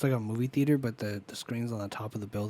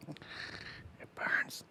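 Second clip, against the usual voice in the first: whispered joke; 11 dB quieter than usual. Pretend. Joking about the sun, whisper.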